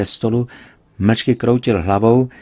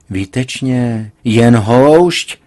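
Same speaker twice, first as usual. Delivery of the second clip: slow, then a loud outburst; sarcastic father shouting out; exclaimed; 3 semitones higher, 7 dB louder, much slower than usual. A narrator's reading. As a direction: burst out sarcastically. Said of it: "vykřikl jsem".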